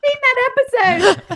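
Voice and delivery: high, loud cry through laughter; real laughing outburst; laughing exclamation. Real, not acted. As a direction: squeal it out while laughing, drawn out. Exclaims amid laughter about show.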